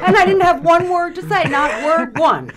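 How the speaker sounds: high, loud, laughing telling; helpless amusement at your own embarrassment. Real, not acted. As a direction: laugh through it, voice lifted high. Laughing at her own embarrassing story.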